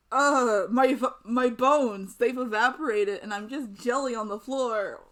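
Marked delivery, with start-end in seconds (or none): none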